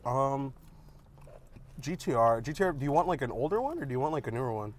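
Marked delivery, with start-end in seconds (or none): none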